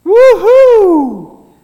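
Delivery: high, loud cheer; cheering woohoo. Shown as a demonstration. Children's talk leader demonstrating a cheer, loud whoop. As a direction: cheer like a teammate took a mark. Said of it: "You say".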